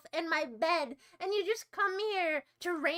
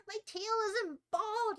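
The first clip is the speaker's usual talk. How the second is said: higher, slow, whiny "bald"; whiny put-on voice; 5 semitones higher, slower than usual. Streamer whining at chat in a cartoon cat voice. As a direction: whine it like a sulky cartoon cat.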